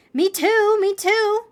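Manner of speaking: high, loud eager repeat; excited child. Acted. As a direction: burst in, wanting to be included. Child excitedly shows own name.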